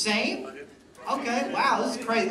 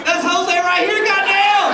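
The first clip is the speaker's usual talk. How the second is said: high, very loud hammed-up shout; mock boss yelling; 9 semitones higher, 8 dB louder, slower than usual. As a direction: holler it across the body shop in mock outrage. Acted boss yelling at a worker.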